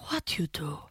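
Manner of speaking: put-on hushed whisper of a shocked old man; a retold whispered question. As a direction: whisper it in disbelief. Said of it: "han bara".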